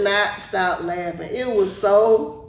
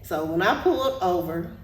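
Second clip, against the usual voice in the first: ordinary talk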